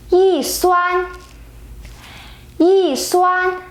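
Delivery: "Yi" falls from high, then "suan" stays high and level; changed fourth tone. Shown as a demonstration. Teacher showing yi before first tone: falling "Yi", high level "suan". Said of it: "yi become the fourth tone".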